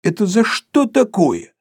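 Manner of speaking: high, drawn-out indignant question; frightened. A narrator's reading. Sharikov protests the fine, frightened. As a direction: protest in alarm, pitched well up. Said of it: "испугавшись".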